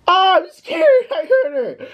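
very high falsetto panic; mock scared squeal. Retelling his reaction to the girl's cry, mock scared squeal. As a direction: squeal like you're terrified, hammed up.

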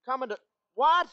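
high, startled question; sudden high alarm; shocked. Acted. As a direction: jump up in alarm. Shocked at being sold off.

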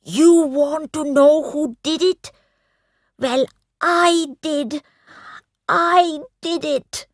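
high, loud furious scream; a boy screaming his guilt at his stepmother. A narrator's reading. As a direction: scream the confession at her. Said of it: "He screamed".

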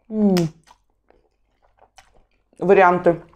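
slurping noodles while talking; chewing between words; mouth full of noodles. Real, not acted. Seen 0: Noodles hang from mouth then she chews with mouth full.

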